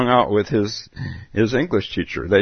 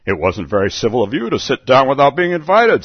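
higher, louder indignant retort; March Hare scolding Alice, louder; 5 semitones higher, 5 dB louder than usual. Acted. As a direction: fire it back at her.

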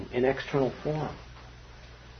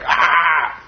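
mock frustrated shout. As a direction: let out a mock yell of frustration. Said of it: "I'm just like".

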